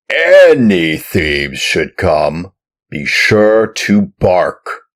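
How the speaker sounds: loud, gruff, slowing, menacing warning; gruff farmer warning. Acted. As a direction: lean in harder on each threat. Farmer's last warning to puppet.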